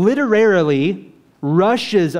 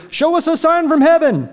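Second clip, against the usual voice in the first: mocking demand; 11 semitones higher, 4 dB louder than usual. Voicing Pharisees' demand.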